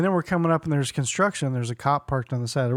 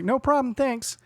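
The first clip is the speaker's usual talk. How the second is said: high-pitched, put-on easy thanks; breezy agreement; 9 semitones higher, slower than usual. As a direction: act out a chirpy, compliant reply. Acting his chirpy reply to her.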